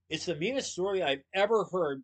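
appalled, throw it out loud; crying out in disbelief. A narrator's reading. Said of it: "Willie cried in disbelief".

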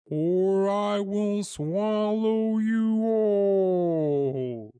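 very slow, high, wailing whale call; drawn-out swooping whale threat; bellowing whale. A narrator's reading. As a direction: run the words into one long moan. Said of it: "bellows whale".

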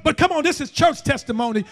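ordinary talk, nothing marked

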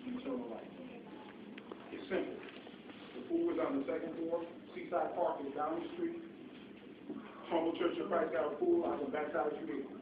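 low, soft pleading invitation; gentle altar call. Acted. Preacher quietly inviting listeners to baptism, low and soft. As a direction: invite them softly to be baptized.

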